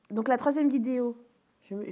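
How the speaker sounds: ordinary talk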